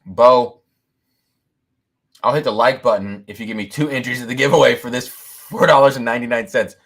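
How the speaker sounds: ordinary talk, nothing marked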